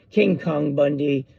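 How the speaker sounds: normal talking voice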